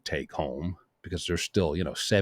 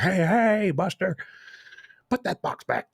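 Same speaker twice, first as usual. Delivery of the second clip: mock calling out; 11 semitones higher than usual. Calling the waiter back with the box, mock holler. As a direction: pretend to call someone back indignantly.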